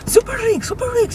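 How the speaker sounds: very high squeaky imitation of him; silly high-pitched mock cry; weird noises. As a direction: mimic his weird noise in a piercing falsetto. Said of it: "he's always making weird noises".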